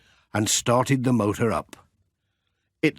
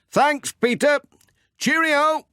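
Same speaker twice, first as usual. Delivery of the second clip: high, louder call across the road; calling goodbye from a distance; 3 dB louder than usual. Acted. Pat calling thanks to Peter while driving off, high and loud.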